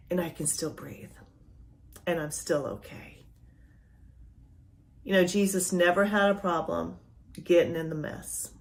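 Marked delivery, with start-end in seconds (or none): 0.1-3.1 much softer, breathy-free whisper of relief; hushed realization. Retold or imitated. Preacher voicing a believer's quiet realization, whispered. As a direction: let the words fall to a whisper.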